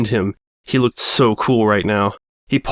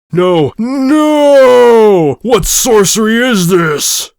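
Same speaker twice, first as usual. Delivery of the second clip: much louder and higher, howling in rage; anguished roar; 10 semitones higher, 8 dB louder, much slower than usual. Acted. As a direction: howl it at the sky. Villain despairs on knees.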